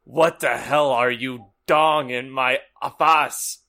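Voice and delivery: loud, hammed-up bellow; angry Dumbledore. Dumbledore finding intruders in his office, loud angry bellow. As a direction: bellow it, saying "dong" as written. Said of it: "read that how it's written".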